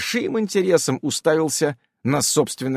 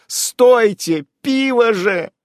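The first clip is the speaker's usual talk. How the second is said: high, loud desperate shout; 10 semitones higher, 6 dB louder, much slower than usual. A narrator's reading. Hero crying out for lost beer, high and loud. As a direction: yell after the beer. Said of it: "я даже выкрикнул это вслух".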